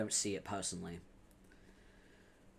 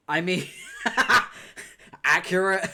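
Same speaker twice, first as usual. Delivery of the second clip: laughing; 7 semitones higher, 11 dB louder than usual. Real, not acted. Laughing at chat's remark.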